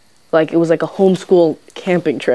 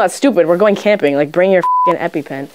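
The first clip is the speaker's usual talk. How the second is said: ordinary talk, nothing marked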